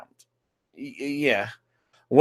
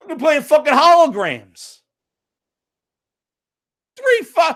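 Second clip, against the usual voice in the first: incredulous outburst; 9 semitones higher, 3 dB louder than usual. Real, not acted. Incredulous rant about tech years.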